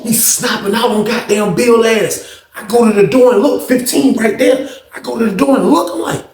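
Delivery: ordinary delivery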